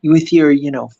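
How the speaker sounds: lightly acted angry customer; mock Karen. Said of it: "she starts, like, going".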